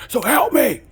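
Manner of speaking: high and loud, begging his teammates; a mock rider yelling a plea to his teammates; pleading yell. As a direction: plead for their help at the top of his voice. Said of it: "And I said".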